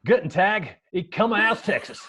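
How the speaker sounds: German in a thick Texan twang; hammed-up Texan-accented German; strongest Texan accent. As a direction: speak German like an over-the-top Texan. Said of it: "with the strongest Texan accent, speaking German".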